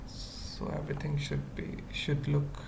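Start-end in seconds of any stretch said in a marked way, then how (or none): none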